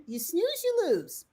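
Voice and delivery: high, playful taunt, pitch climbing; teasing singsong. Pretend. Host teasing LinkedIn in a high playful singsong. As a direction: tease them like a schoolyard rhyme.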